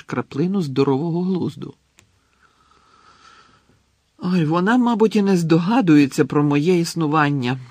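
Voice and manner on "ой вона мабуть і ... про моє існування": higher, louder cry of despair. A narrator's reading. Lovesick boy lamenting to his friend, loud mock despair. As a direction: throw it away hopelessly. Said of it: "розпачливо махнув рукою Рон".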